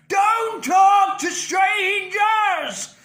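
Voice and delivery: high, loud, slow belted rock cry. Shown as a demonstration. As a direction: belt it like an old wizard. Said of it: "not letting the sound out too much".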